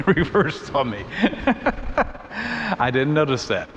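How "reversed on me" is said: laughing. Real, not acted.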